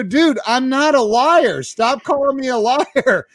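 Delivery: high-pitched mock exasperation; pissed off. Customer fed up with being called liar, indignantly pissed off. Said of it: "I got really pissed off".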